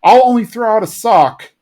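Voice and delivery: high put-on boy's voice; Bobby. Bobby answering the kids outside the closet, high imitation. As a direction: pitch it up, imitating a kid calling back. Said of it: "Bobby's like".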